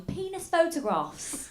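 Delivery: mock British accent, drawn out; put-on British elegance. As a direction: say it slowly in imitation British. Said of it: "they don't call them dick pics, they call them".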